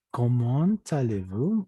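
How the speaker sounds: slow "comment allez-vous" with T linking "comment" to "allez"; liaison T pronounced. Shown as a demonstration. Teacher shows the rule before a vowel, "comment allez-vous" with sounded T. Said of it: "se pronuncia".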